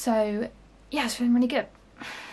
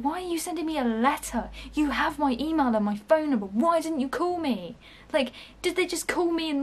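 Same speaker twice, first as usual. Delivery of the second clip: exasperated rant; 6 semitones higher than usual. Real, not acted. Ranting at the company.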